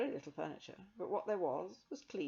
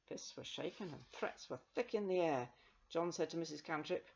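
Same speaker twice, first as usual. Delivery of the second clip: ordinary delivery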